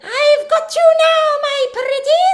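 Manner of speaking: high, loud, slow nasal witch threat; wicked witch. Shown as a demonstration. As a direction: gloat like a storybook witch. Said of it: "in a very witchy nasal voice".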